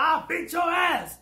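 a hint of a menacing bully; retold threat. Big woman threatening on the bus, mock threat.

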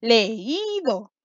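leído drawn out on a long í; le-ÍÍ-do, slowly sounded out; test stress on í. Shown as a demonstration. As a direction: stretch and lean on the "í" of leído. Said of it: "podemos hacer la prueba".

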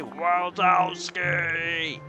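slow, stretched mock Roz drawl on Wazowski; drawn-out Roz voice. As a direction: drag the name out like a weary boss. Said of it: "decide how to say".

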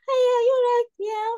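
very high, loud chirpy greeting; mock shop greeting; imitating greeting.

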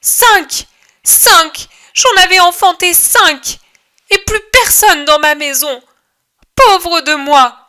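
high, loud wailing cry of a bereaved mother; strident woman's cry. Acted. Grieving mother crying out to the crowd, high piercing wail. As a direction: scream out your lost children, voice cracking. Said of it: "une voix stridente de femme crie".

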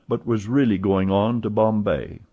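ordinary delivery

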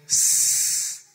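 long voiceless snake hiss. Acted. Snake puppet hisses.

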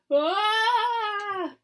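long, high, loud "ah"; tumbling scream. Acted. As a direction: yell as if falling down rocks. Tumbling down rocks.

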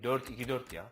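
usual speaking voice

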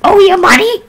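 Toad. Acted. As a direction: squawk it like a cheeky little mushroom man. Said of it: "time to do Toad's voice".

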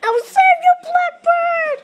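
high, loud rescue call; heroic cry. Acted. Bird vowing to rescue Blackbird, high and loud. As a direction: charge in to save him.

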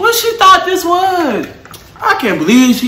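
loud, high disbelieving shout. Real, not acted. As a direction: yell it in disbelief.

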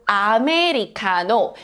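Americano in Korean syllables, "a-me-ri-ka-no"; Korean pronunciation. Shown as a demonstration. Teacher repeats the borrowed word for learners, Korean-pronounced Americano. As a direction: give Americano Korean vowels and a tapped r. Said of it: "English words in Korean writing".

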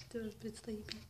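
very soft, whispered aside; hushed. Real, not acted. Speaking of a sleepy pet, hushed. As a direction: whisper it so as not to disturb.

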